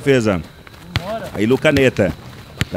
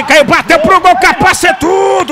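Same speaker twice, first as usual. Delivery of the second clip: high shouted call; excited commentary; 11 semitones higher, 4 dB louder, slower than usual. Real, not acted. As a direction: shout the save. Shot and save call.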